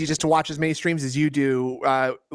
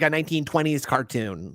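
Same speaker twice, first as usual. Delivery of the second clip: ordinary talk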